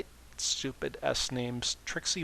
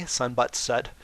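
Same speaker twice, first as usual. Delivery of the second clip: ordinary delivery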